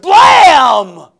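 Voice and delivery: excited mock gunshot; gunshot blam. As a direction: shout the bang like a pistol going off. Imitating a gunshot again.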